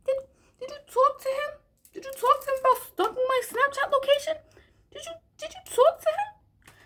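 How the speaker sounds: high squeaky put-on voice, on the edge of a fake cry; mock whiny plea. Pretending to plead with the boy's mom, squeaky and whiny. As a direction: whine it like an upset little kid. Mocking her pleading to the mother.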